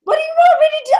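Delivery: very high mock-exasperated squeal. Reader answering the frustrated guides, exasperated. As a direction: throw it back in a comic high voice.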